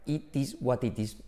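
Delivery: slow "It is what it is" with hard Ts, Spanish accent; Spanish-accented English. Shown as a demonstration. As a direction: wooden word-by-word Spanish-learner "It is what it is". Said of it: "No puedes decir".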